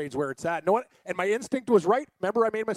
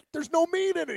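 much higher, a mock-indignant child; indignant kid; 11 semitones higher than usual. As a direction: squeak the complaint like a boy. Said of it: "I'm like".

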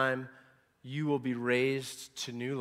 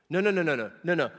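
louder, higher run of no's, shaking it off; emphatic no; 3 semitones higher, 5 dB louder, faster than usual. Real, not acted. Preacher to congregation, rejecting other teachers, loud repeated no. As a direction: refuse them flatly, building volume.

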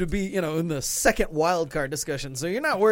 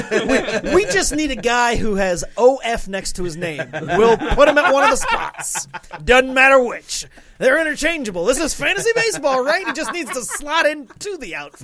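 higher, loud, blithely confident; mock front-office pitch; 5 semitones higher, 4 dB louder than usual. Mocking team filling outfield spots, blithe enthusiasm. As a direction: sell the silly plan with gusto.

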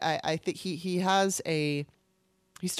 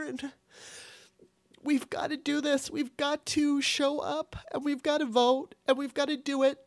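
tearful, voice cracking, higher pitch; choked-up plea to vote; crying; 7 semitones higher than usual. Real, not acted. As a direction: speak through real tears. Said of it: "I'm not really sorry for crying".

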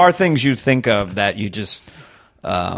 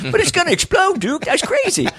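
much higher, whiny sidekick squeal; Walter Brennan. Retold or imitated. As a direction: panic high like an old sidekick. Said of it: "Walter Brennan".

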